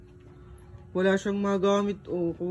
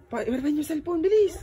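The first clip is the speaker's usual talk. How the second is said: calling out; 8 semitones higher than usual. Real, not acted. Vlogger calling the kids to lend a phone.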